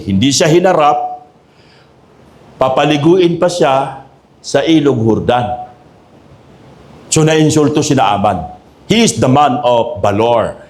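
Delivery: ordinary talk, nothing marked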